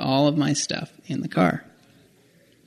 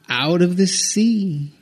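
ordinary delivery

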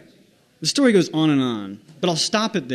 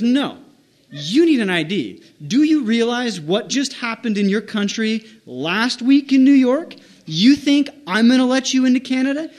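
mock-incredulous guard, rising higher and louder; retold indignant scolding; 6 semitones higher, 4 dB louder than usual. Border guard refusing a kid without passport, indignant scolding. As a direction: build to a loud, high-pitched dressing-down.

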